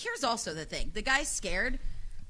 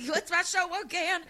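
mock forgetful; 7 semitones higher than usual. Mock forgetting her own job.

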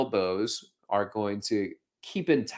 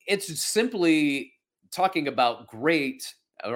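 normal talking voice

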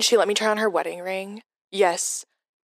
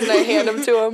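ordinary delivery

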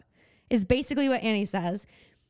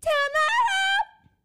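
much higher, slow drawn-out wail; child actor wail; much slower than usual. Retold or imitated. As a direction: wail the name like a stage kid.

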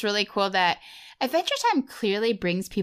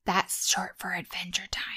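quick unvoiced aside; whispered aside; 5 dB quieter than usual. Real, not acted. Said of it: "whispered aside while reading".

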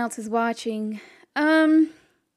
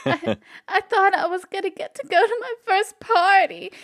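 put-on crying boy; 9 semitones higher, 5 dB louder than usual. GM voices boy Seamus crying.

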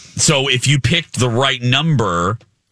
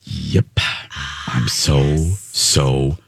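low, flat fake excitement; deadpan excited; 9 semitones lower, much slower than usual. Shown as a demonstration. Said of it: "so excited".

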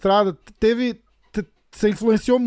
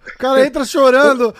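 louder, higher amused exclamation; amused outburst; 4 semitones higher, 6 dB louder than usual. Real, not acted. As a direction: burst out, tickled by the absurdity. Amused outburst at guest's crying story.

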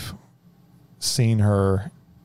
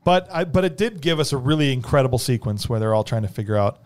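usual speaking voice

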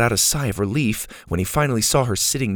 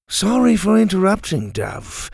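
Dante, politely interrupting; 9 semitones higher than usual. Acted. Dante cutting in.

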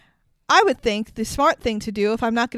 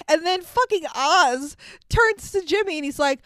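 ordinary talk

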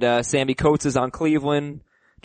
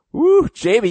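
excited whoop; 10 semitones higher, 6 dB louder, slower than usual. Real, not acted. Reacting to the reset-league email.

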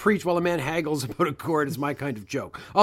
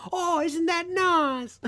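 mock prim wife; 12 semitones higher, much slower than usual. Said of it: "Big Nose's wife's comment".

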